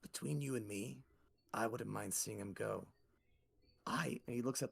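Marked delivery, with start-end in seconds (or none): 0.0-2.9 low-key, hushed aside; confiding. Acted. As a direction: keep it between the two of you. Gator confides to newcomer.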